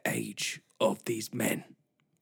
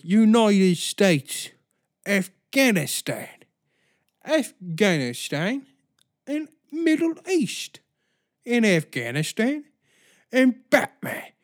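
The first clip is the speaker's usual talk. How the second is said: mock radio rant; 8 semitones higher, 3 dB louder than usual. Parodying radio host.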